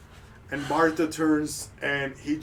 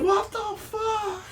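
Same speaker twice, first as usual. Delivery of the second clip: much higher, slow, laughing; shocked crowd; slower than usual. Acted. Everyone reacting to the whistle, much higher. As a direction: gasp it out in disbelief. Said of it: "everyone's like".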